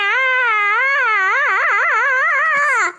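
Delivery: ghostly wail. Acted. Ghost cries out between chants.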